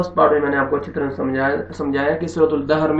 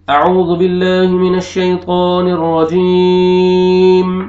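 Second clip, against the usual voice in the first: slow, chanted tajweed recitation, higher and louder; Quranic recitation; 3 semitones higher, 7 dB louder, much slower than usual. Acted. Teacher opening the lesson for students, slow melodic recitation. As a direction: intone it slowly like a qari.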